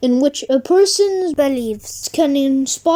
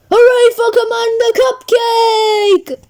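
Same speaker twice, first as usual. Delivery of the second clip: cheering shout; 7 semitones higher than usual. Acted. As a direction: cheer for the hero. Crowd cheering the hero.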